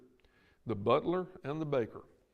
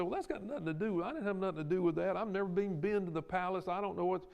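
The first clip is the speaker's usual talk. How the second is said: raised, fast, defensive protest, mildly hammed; dismissive brush-off; 6 semitones higher, faster than usual. Preacher voicing someone who wants no part of it, a touch of defensive whine. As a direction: shrug it off as none of your business.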